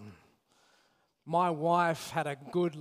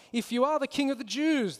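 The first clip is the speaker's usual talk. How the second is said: mocking; 7 semitones higher than usual. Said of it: "they mock".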